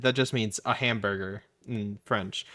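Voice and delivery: ordinary talk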